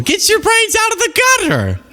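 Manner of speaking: high, laughing shout at the chat. Real, not acted. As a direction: yell it through a laugh. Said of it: "laughing at the chat".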